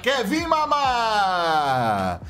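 nome esticado bem devagar; grito de gol. Acted. Play-by-play call of a kill. (narrador esticando o nome do jogador)

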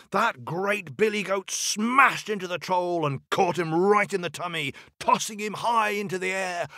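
high-pitched, breakneck storytelling energy; excited narration. A narrator's reading. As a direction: drive the action up to its climax. Narrator tells the goat's charge.

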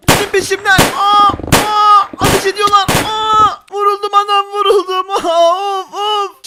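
high, loud wailing under fire; hammed-up cries of a man shot; mock panic. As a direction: yelp in pretend agony. Fleeing gunfire, pretends to be shot.

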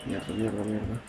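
muttered curses, very soft; cursing under breath. Real, not acted. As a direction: swear quietly to yourself. Getting eaten in game.

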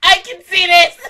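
imitating a loud little kid; mock kid yell. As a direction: yell it across the room like a kid. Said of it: "Just yelling across the room".

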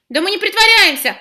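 loud, high protest; exclaiming. Acted. Girl protests to the teacher, exclaiming loudly. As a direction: insist loudly you are not faking. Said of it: "воскликнула Яна".

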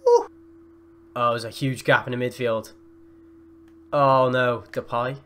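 ordinary delivery

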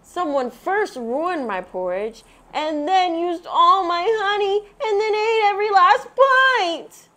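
a small bear about to cry; Baby Bear voice. A narrator's reading.

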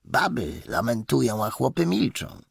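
usual speaking voice

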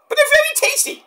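put-on very high childlike voice; Cactus pleading. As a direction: coax her sweetly like a kid. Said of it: "Mick Foley impersonation".

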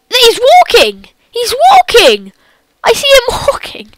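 high, loud astonished cries; amazed disbelief, breaking into laughter; shocked. Real, not acted. Sees Slender walking, laughs.

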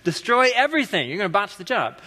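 a hint of a scolding wife, half laughing. Husband quotes his wife stopping his weed whacking, mild mock scold. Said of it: "Jessica's response will be to me".

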